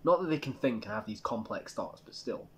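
ordinary talk, nothing marked

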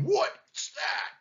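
high-pitched indignant question; roaring lion. A narrator's reading. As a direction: demand it like a rudely woken lion. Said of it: "roared the lion".

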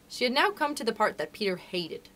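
usual speaking voice

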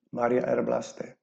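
ordinary delivery, nothing marked

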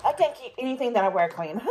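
laughing loudly through the words. Real, not acted. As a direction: laugh it out, embarrassed. Laughing at spilling on herself.

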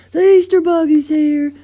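high, very loud holler; full-voiced, high-pitched alarm; excited shout. A narrator's reading. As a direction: shout the big news to everyone. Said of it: "Mater shouted".

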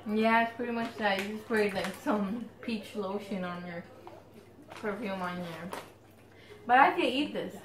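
chewing between words; snack held at mouth; talking while eating chips. Real, not acted. Seen 1: Both women eat chips throughout; which one speaks is unclear.

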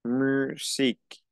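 Musique slow, mü-zeek with a French ü; French pronunciation. Shown as a demonstration. Teacher to students: Musique said slowly in French. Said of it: "dos palabras que son casi igual".

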